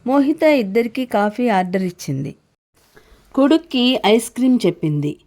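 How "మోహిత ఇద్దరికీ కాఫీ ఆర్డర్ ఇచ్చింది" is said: plain reading voice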